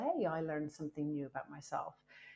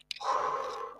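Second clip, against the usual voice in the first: a long, slow breath out to calm down; calming sigh. Retold or imitated. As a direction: let out one deliberate steadying breath. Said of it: "I just stopped and I went".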